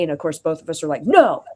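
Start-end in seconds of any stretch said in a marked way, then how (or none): 1.0-1.4 loud, high flat refusal; emphatic no. Retold or imitated. Both answering the waiter's question, a loud fed-up no.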